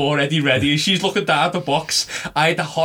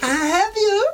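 mock villain, much higher; villain gloat; slower than usual. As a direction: gloat like a cartoon baddie. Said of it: "I'm like".

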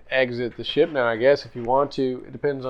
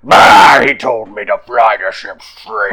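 loud exclamation; 17 dB louder, slower than usual. Real, not acted. GM exclaims at own mistake.

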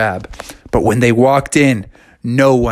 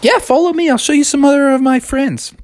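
high, eager mermaid voice; 12 semitones higher, faster than usual. Acted. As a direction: chirp the invitation up high. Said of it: "So she's like".

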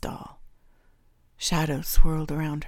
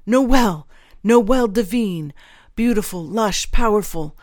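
loud, raised-pitch rapturous moan; overcome, moaning recollection; 4 semitones higher, 8 dB louder than usual. A narrator's reading. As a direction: moan the name as if triggered by it. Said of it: "Stahl moaned, as if triggered by the name".

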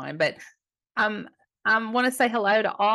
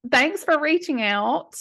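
ordinary talk, nothing marked

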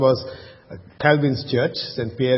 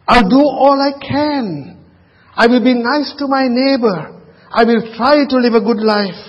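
a higher-voiced hint of an eager do-gooder listing good deeds; mild mock pious pledge, higher than the preacher's voice; earnest self-reliant believer; 6 semitones higher than usual. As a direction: lightly play someone sure they can earn heaven. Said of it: "It might be like this".

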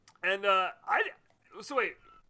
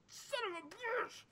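much higher, slow, squeezed curse; retold curse; 13 semitones higher, 7 dB quieter, slower than usual. Fan retelling the spoiler shock, high-pitched curse. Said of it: "and I was like".

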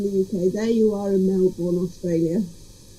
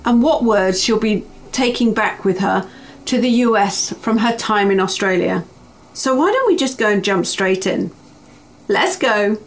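upbeat host read of the episode preview, a touch louder; podcast intro read; 4 dB louder than usual. Acted. Host previewing the guest for listeners, lively promo read. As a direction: present the episode like a show trailer.

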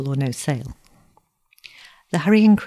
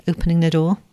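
plain reading voice

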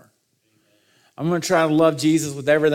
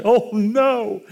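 high-pitched retold dread; mock dismay; 7 semitones higher than usual. Dad realising the baby won't stop crying, mock dismay.

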